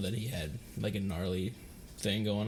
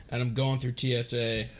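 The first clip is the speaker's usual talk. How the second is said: ordinary delivery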